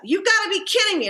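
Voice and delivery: high, exasperated disbelief; upset exclamation. Retold or imitated. Customer told espresso machine broke, exasperated. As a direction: scoff at the news in outrage.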